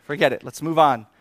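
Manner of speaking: loud, fed-up snap; exasperated outburst. Retold or imitated. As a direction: blurt it out, done with it all. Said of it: "I just was like".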